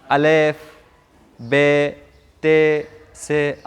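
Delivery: put-on अलेफ, बे, ते, से recited the old way; old-style letter names. Shown as a demonstration. Teacher shows the old-fashioned recitation, lightly chanted. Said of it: "पुराने ज़माने वाला".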